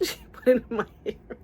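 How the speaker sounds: high, laughing half-wail; laughing dismay. Real, not acted. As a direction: squeeze it out through laughter. Reliving the shock, laughing.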